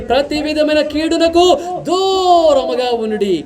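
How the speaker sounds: formal recitation of scripture; scripture reading. Acted. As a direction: read the verses out solemnly, like from the pulpit. Reads scripture aloud.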